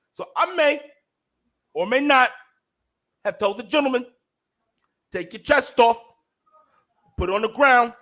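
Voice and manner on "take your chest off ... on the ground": raised, higher and louder, biting each word; firm telling-off. Retold or imitated. To a nosy man at the pharmacy, a higher, louder stern retort. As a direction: put the meddler in his place with a stern retort.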